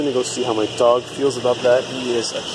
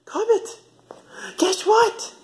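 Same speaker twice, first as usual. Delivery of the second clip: much higher sing-song voice for the dog; pet talk; much slower than usual. Real, not acted. Owner teasing her dog with news, high pet voice. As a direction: coo up high like talking to a puppy.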